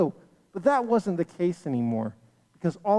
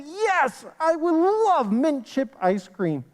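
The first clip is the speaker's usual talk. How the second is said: higher, delighted yes with slow emphasis; excited acceptance; 7 semitones higher, slower than usual. Acted. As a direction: act out a kid's delight at a treat. Child offered favorite ice cream.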